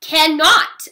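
loud, high shouted contradiction; storybook scream. A narrator's reading. Sister argues with brother over ballet, screaming. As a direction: yell it back in a squabble. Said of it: "I screamed".

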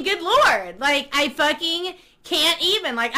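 usual speaking voice

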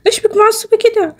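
high, sweet-talking mom; mother's voice. Acted. Mother asks why Zeina is angry, high and coaxing. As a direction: coax her like a fussing mother.

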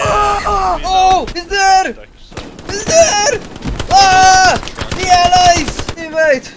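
high loud alarmed yell; alarmed shouting. Acted. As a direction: shout the warning. Enemy spotted, shouting.

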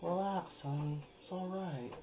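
soft, tender comforting; hushed, affectionate reassurance; loving fiance. Acted. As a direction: soothe a partner quietly. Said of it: "if my fiance would say".

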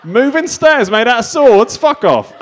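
high, loud, slow incredulous shout; Henry the eighth. Acted. Old king baffled by escalator, incredulous shout. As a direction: exclaim in outraged comic disbelief. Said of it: "This is Henry the eighth, but he's on an escalator".

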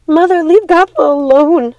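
high, loud shout at her mother. A narrator's reading. Primrose defending her sister against their mother, shouting. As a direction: throw yourself between them with a cry. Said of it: "shouted Primrose".

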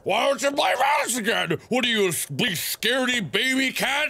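high-pitched mocking whine of nagging viewers; jeering imitation of complainers; taunting viewers. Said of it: "people constantly going".

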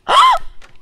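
shocked gasp, very high; sharp high gasp. Real, not acted. Gamer gasps after deleting the urn, high and sharp. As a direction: gasp in horror.

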